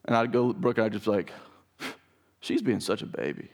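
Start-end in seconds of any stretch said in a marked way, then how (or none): 2.4-3.5 put-on quiet teasing jab at the crying baby; softer, playfully mocking remark; teasing aside. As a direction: murmur it like a private joke to your wife. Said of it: "Like that".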